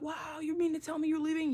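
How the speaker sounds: high-pitched sarcastic wonder; teasing, put-on astonishment; mock amazement. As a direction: imitate an astonished listener, high and exaggerated. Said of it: "I know that sounds really profound".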